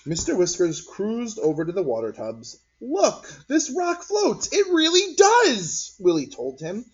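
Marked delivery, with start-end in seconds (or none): 2.8-5.9 put-on high, slow insistent kid; a boy insisting his rock floats; excited child. A narrator's reading.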